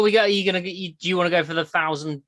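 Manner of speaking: ordinary talk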